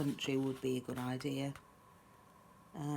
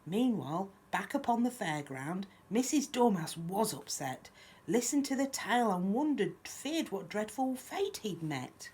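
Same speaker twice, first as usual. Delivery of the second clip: lively, lilting picture-book reading; children's story narration; 4 semitones higher, 4 dB louder than usual. A narrator's reading. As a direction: read a rhyme aloud to little ones. Reads picture book aloud.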